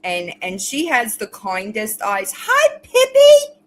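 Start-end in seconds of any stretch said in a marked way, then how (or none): 2.4-3.6 delighted high pet voice; pet greeting. Real, not acted. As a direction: squeal hi to the pet, stretched out.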